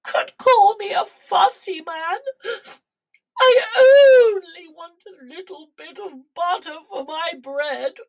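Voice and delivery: high, loud, self-pitying king's wail; hammed-up whimper. A narrator's reading. King complains about his butter, play-whimpering. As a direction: plead like a sulking royal. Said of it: "he whimpered".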